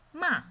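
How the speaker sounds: MA high and loud, falling sharply; fourth tone. Shown as a demonstration. Teacher practices the fourth tone, sharp fall. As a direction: drop "ma" hard from high. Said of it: "Fourth one".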